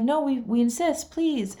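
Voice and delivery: imitating insistent vendors; kind insistence. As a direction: press the gift on them, voice up high.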